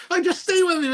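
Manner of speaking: very high put-on voice through laughter; laughing mock plea. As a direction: squeak it out while cracking up.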